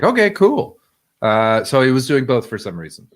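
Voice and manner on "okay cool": high chipper. Retold or imitated. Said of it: "I was like".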